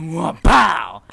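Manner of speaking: loud, explosive "pow"; imitated impact noise. Storyteller imitating the force of the hit, loud impact noise. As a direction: act out the hit with a loud sound effect. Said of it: "all the force just went".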